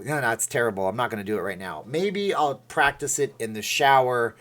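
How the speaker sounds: ordinary talk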